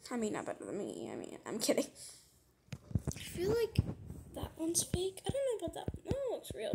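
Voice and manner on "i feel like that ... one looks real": hushed doubtful whisper. Real, not acted. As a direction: whisper it like a secret. Whispered doubt about fakes.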